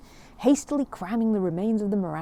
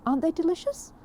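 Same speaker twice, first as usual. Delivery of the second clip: bright high praise of the meringues; a mother gushing over a treat; Mrs Brown, delighted; 10 semitones higher than usual. Acted. Mrs Brown to the family, praising the meringues, high and delighted.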